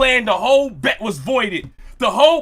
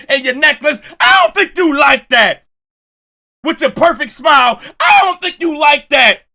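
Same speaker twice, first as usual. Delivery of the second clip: mock hating rant; 3 semitones higher than usual. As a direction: taunt him, building up. Hating on the player in gym.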